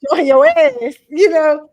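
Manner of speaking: loud laughing scold. Retold or imitated. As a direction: belt it out, half laughing.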